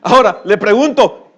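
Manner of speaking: high, loud preacher's challenge; fiery preaching. Acted. Preacher challenges the congregation at full force, loud and high. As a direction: hammer the question at the congregation.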